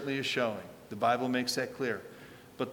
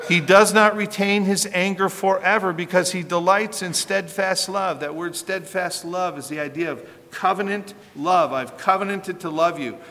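rising, louder preaching build; fervent preaching; 3 semitones higher, 6 dB louder than usual. Acted. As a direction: drive the point home to the congregation. Preaching at full force again.

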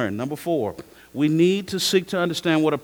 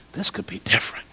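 whispered point; 4 semitones lower, 3 dB quieter than usual. Retold or imitated. Soft close to counsel.

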